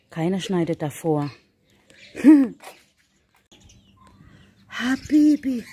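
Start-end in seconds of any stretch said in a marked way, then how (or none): 2.1-2.7 short high laugh. Real, not acted. Laughs at remark. 4.7-5.6 high cooing pet name. Real, not acted. Greeting the cat.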